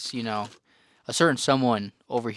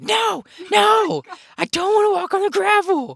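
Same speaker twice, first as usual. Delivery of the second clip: much higher, whiny mock scream; 6 dB louder than usual. Sister refusing to walk on gravel, high mock wail. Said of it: "started screaming and said".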